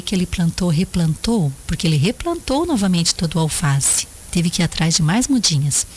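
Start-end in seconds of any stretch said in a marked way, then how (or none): none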